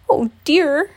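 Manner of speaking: high, loud, out-of-breath bear; gasping. A narrator's reading. Pooh flees the angry bees, gasping. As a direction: gasp it out between pants. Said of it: "gasped Pooh between pants".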